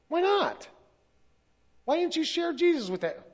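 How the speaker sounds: high, loud, incredulous challenge; indignant demand. Retold or imitated. Boss confronts his employees for not sharing, indignant. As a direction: call them out sharply, voice jumping up.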